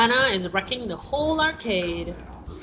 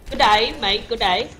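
light mock Australian accent; 6 semitones lower than usual. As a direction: lower mock Australian "good day, mate". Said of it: "It sounds so Australian".

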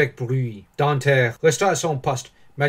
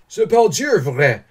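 louder, higher burst of delight; joyful exclamation; 5 semitones higher, 6 dB louder than usual. A narrator's reading. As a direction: exclaim it with sudden joy. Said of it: "s'écria l'amateur, tout joyeux". (exclamation toute joyeuse)

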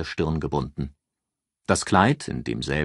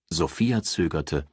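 ordinary delivery, nothing marked

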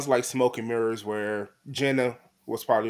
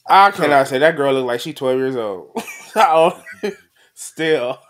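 louder, words breaking into laughter; laughing while talking; 3 semitones higher, 9 dB louder than usual. Real, not acted. Laughing at the joke about how young she looks. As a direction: push the words out through a laugh.

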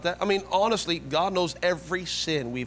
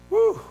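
high, loud "woo"; sudden high holler; excited whoop; 7 dB louder than usual. Real, not acted. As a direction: let out a short preacher's whoop. Preacher exclaims after the verse.

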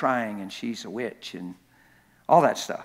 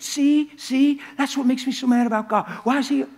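much higher and louder, indignant; angry hurt person; 11 semitones higher, 6 dB louder than usual. Retold or imitated. People in pain complaining about God, high and heated. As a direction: throw it out like someone mad at God. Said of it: "They're saying".

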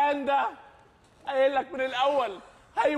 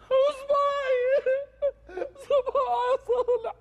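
tearful wail; 9 semitones higher, slower than usual. Acted. Man wails over his finger.

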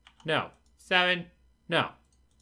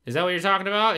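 usual speaking voice

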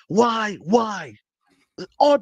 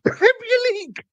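squeaky voice; 13 semitones higher than usual. Real, not acted. Fed-up fan's voice squeaking up high in frustration. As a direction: voice cracks up high on the words. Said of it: "When his voice goes squeaky".

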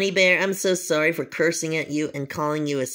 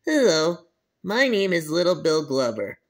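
ordinary delivery

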